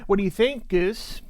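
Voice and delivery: ordinary talk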